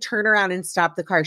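ordinary delivery

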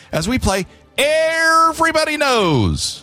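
slow, high game-show announce; announcer. Acted.

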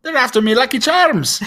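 high, loud Irish leprechaun voice; Irish accent. Pretend. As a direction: squeak it out like a cereal-ad leprechaun. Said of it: "We'll put on our best Irish accents".

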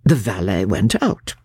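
ordinary delivery

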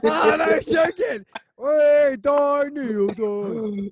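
high, loud joking yell; mock shout. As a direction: bellow it as a playful threat. Mock threat shouted at friend.

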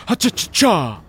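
jazzy catchphrase cry; dancer's flourish. Acted. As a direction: scat it like a vaudeville dancer recovering. Acting out the stumbling Charleston dancer.

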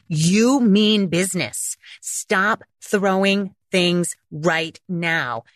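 slow, firm command, word by word; mean business, in full control; security guard voice. Acted. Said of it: "Security guard is like".

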